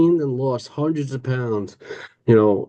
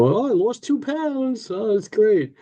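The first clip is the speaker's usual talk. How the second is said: much higher, slow, hammed-up dieter's cheer; mock cheery dieter; 9 semitones higher, slower than usual. Mocking his old self, cheering a two-pound loss in a silly high voice. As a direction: chirp it like a proud silly dieter.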